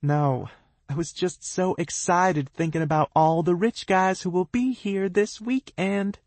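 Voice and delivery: high sing-song lilt; sing-song it sweetly. A narrator's reading. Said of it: "she says in a sing-song voice".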